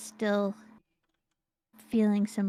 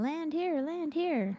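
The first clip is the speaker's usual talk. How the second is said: beacon saying; 6 semitones higher, 3 dB quieter, slower than usual. Retold or imitated.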